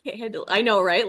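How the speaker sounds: usual speaking voice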